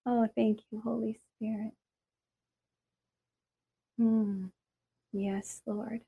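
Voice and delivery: soft whispered prayer, slow; hushed prayer. Real, not acted. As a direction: murmur it to God, barely voiced. Quiet prayer to the Spirit.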